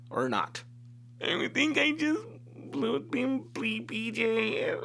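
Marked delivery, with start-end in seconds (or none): 1.2-4.8 put-on high, drawn-out weepy girl voice; mock crying confession. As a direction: wail it slowly like a guilty girlfriend. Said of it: "she tells me she's crying".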